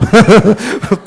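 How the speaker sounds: short chuckle at own punchline; amused laugh. Real, not acted. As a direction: break into a brief real laugh.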